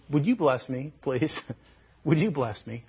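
hushed mock begging; soft plea. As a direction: softly beg for a blessing, clinging on. Acting Jacob clinging, begging for blessing.

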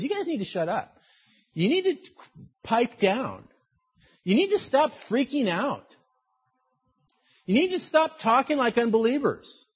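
lightly hammed-up scolding, pitch jumping up; exasperated telling-off. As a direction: tell them off bluntly, pausing between orders. Blunt paraphrase of telling off the people.

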